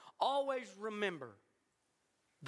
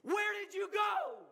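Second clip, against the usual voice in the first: crying out; 7 semitones higher than usual. Retold or imitated. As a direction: call out to God in distress, rising to a shout. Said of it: "I'm crying out to him".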